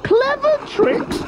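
very high, drawn-out disbelief; shriek-high, scornful echo of the words; small croc squealing in disbelief; incredulous cry. A narrator's reading. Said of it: "cried the not-so-big one".

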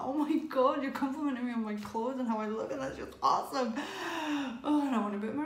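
excited. Retold or imitated. Retold thrill at compliment.

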